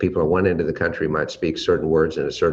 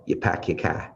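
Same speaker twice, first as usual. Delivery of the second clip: a light Boston accent, "pack" with no R sound; a hint of Boston, dropping the R; 4 dB quieter than usual. Shown as a demonstration. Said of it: "they tack different".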